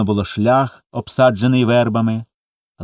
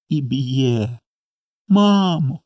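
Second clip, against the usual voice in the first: breaking into tears; 3 semitones higher, much slower than usual. A narrator's reading. Little boy tells mother of beatings, starting to cry. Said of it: "заплакав одразу Коля".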